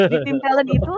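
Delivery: bursting into a laugh mid-answer; gleeful laughing aside. Real, not acted.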